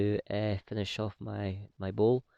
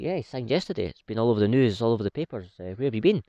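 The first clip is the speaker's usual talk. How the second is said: higher, louder, incredulous; imitated baffled surprise, raised and loud; surprised; 4 semitones higher, 7 dB louder than usual. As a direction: retell the switchboard man's disbelief. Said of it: "the guy was quite surprised".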